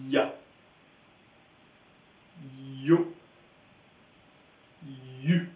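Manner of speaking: drawn Y glide sliding into the vowel in "Ya", "Yo", "Yu"; one syllable each for "Ya. Yo. Yu.", leaning on the Y. Shown as a demonstration. Teacher repeating the correct sound after the wrong one, Y glide. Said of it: "on va dire le son y".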